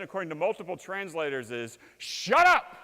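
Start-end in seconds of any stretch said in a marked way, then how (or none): 2.0-2.8 high, loud shout; sharp shout. Shown as a demonstration. Jesus silencing the lake, sharp shout. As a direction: cut the storm off with a yell. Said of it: "The better translation".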